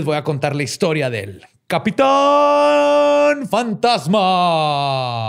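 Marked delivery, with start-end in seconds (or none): 1.7-5.3 slow, louder, drawn-out title reveal; dramatic announcer. Acted. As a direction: unveil the title grandly.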